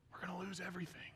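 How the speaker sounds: sudden fast whisper. Real, not acted. Preacher warning the congregation, suddenly whispered. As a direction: drop to a hushed warning.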